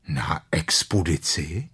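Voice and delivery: slow, rising question with a mild eagerness; understated keen interest; eager question. A narrator's reading. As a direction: ask eagerly, drawing the words out. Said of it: "řekl Pů dychtivě".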